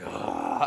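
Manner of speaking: long airy sigh of a substitute; exasperated sigh. Acted. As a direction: breathe out wearily, giving in. Flustered substitute gives in.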